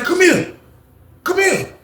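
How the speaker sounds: very high imitated call; summoning.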